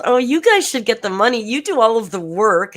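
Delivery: high, loud mocking voice of Amber. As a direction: squeal it up high like a grovelling host. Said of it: "She goes".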